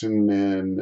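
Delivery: normal talking voice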